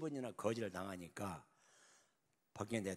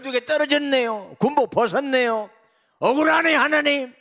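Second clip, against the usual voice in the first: higher, louder with each line; anguished wail; 9 semitones higher, 18 dB louder than usual. Acted. General crying out to God on the way to Blue House. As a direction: plead with God, rising to a cry.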